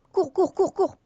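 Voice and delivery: urgent high shouts of run; panicked calls to run; being chased. Real, not acted. Fleeing creepers in game.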